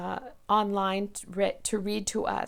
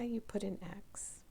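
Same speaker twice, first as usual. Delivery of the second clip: whispered instruction; 13 dB quieter than usual. Shown as a demonstration. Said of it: "whispered aside".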